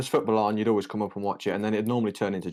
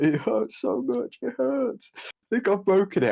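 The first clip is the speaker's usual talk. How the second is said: imitating crying; 11 semitones higher than usual. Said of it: "crying to, like, me and Carl".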